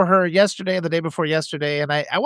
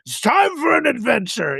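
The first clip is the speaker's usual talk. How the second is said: booming mock wizard call, higher and theatrical; wizard grandpa; 7 semitones higher than usual. Grandpa in a big hat greets the kids as a wizard, hammed-up call. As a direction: announce the adventure like a storybook wizard. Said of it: "with my big hat and just be like".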